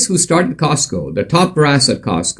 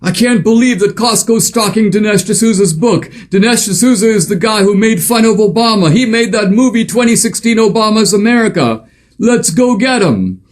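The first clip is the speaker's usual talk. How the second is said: much higher, indignant voice of an Obama staffer; mock outraged operative; 10 semitones higher than usual. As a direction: play a scandalized political insider, pitched way up. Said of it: "someone like that, walks into Costco".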